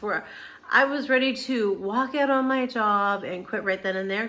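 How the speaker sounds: normal talking voice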